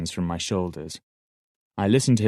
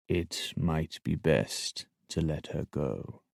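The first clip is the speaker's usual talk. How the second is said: much softer and slow, tender; very gently; 3 semitones lower, 8 dB quieter, slower than usual. A narrator's reading. Shade gently urging Fitz to give up Molly, very softly. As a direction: lay it down as gently as you can. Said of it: "Very, very gently, he said".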